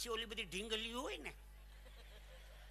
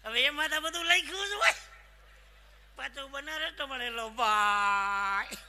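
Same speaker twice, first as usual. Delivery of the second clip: laughing shout; 5 semitones higher, 10 dB louder, slower than usual. Real, not acted.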